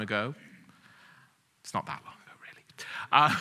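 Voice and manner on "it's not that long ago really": whisper. Real, not acted. Aside joking about own age.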